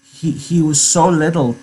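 put-on a touch of stammering childlike grief; woeful. A narrator's reading. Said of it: "Lenny's woe came back on him".

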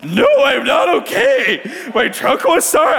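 high, tearful wail; weeping. Retold or imitated. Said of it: "start weeping".

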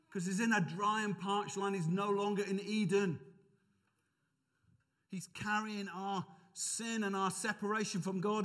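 usual speaking voice

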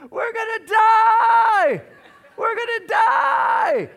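very high, very loud drawn-out shriek; imitated toddler screaming in full panic; screaming three-year-old. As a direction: wail it in terror, stretching the last word. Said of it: "screaming at the top of her lungs".

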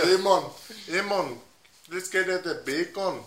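put-on Jamaican lilt; Jamaican accent. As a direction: greet a friend in playful Jamaican. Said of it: "Give me Jamaican".

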